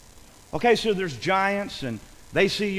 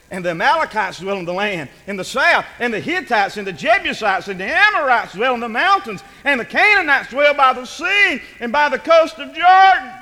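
verse list building louder and higher; building scripture reading; 4 semitones higher, 8 dB louder than usual. Acted. Preacher reading out the list of enemy peoples, building louder. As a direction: climb with each tribe to a loud finish.

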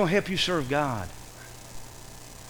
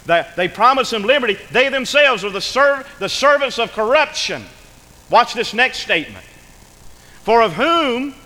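raised, emphatic scripture reading; ringing pulpit proclamation; forceful preaching; 4 semitones higher, 5 dB louder than usual. Real, not acted. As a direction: preach the verse out, loud and high, slowing at the close.

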